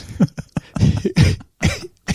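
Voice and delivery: helpless, high-pitched laughing fit; high breathy laugh. Real, not acted. Fighter cracking up at his own drinking story, laughing. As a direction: break up laughing, wheezy and high.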